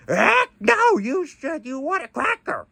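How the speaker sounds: high squawky parrot voice; parrot squawk. Pretend. Parrot answers back.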